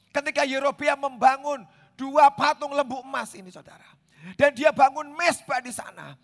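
loud, high, ringing sermon delivery; full-force preaching. Acted. Preacher thundering to his congregation about golden calves, full force.

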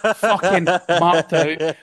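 slow, laughing; amused laughing delivery. Real, not acted. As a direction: laugh the words out. Laughing after impression.

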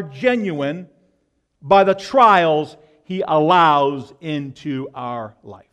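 loud, slow, driving sermon delivery; preaching at full force. Acted. Preacher booming to his congregation about trials. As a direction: hammer each phrase home to the congregation.

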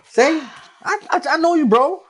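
triumphant. Real, not acted. Husband gloats to his wife that he knows her, high and loud. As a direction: crow about being right.

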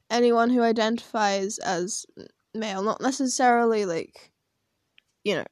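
normal talking voice

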